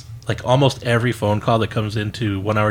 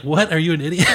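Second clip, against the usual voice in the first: laughing retort; 5 semitones higher than usual. Retold or imitated. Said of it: "And I'm like".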